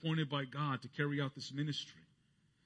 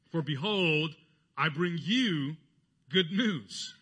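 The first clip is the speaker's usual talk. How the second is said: higher, louder proclamation; angel's announcement; 3 semitones higher, 8 dB louder, much slower than usual. Retold or imitated. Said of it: "the emphasis here of the angel".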